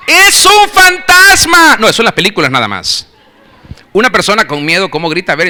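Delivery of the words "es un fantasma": high, slow, drawn-out scream of fear; terrified scream. Shown as a demonstration. Preacher showing how a frightened person screams. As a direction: scream it like you saw a ghost. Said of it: "¿Cómo grita una persona con miedo?".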